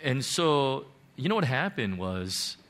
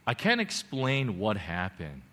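ordinary talk

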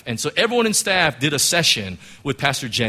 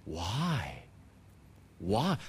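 very soft, low questioning; hushed, searching plea; lingering heartfelt question; 7 semitones lower, 11 dB quieter than usual. Retold or imitated. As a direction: ask it quietly from deep inside. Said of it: "lingering question deep down in my heart".

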